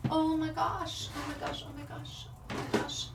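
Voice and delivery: oh my gosh trailing off into a whisper; fading to a whisper. Real, not acted. Can't find gush pads.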